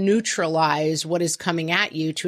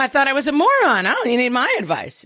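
high, fast smug jab; mock the kid, gloating; hammed-up snide retort; sarcastic gloating; 7 semitones higher, 3 dB louder, faster than usual. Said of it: "continuing the sarcastic retort".

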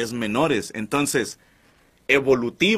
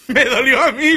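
laughing; 9 semitones higher, 6 dB louder than usual. Real, not acted. Laughing at rejected bouncer.

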